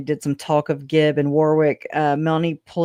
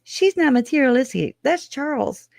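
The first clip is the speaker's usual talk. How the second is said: higher, mocking retelling of Summer; imitating a defensive relative, voice raised; quoting Summer; 4 semitones higher than usual. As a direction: pitch it up to quote her mockingly. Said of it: "Summer says".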